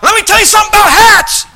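high, loud preacher's yell; mock-furious shout bursting out; explosive outburst. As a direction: blurt it out at full volume. Acting out pent-up urge to blurt.

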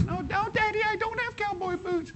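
put-on very high child's protest; squeaky little girl arguing. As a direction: play the small girl insisting to her daddy. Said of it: "And she said".

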